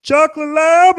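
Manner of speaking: high, loud, odd-voiced stranger asking; goofy grown man. Stranger in the crosswalk asking about the dog, high goofy voice. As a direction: pop the question up high like an overeager stranger. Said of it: "and goes".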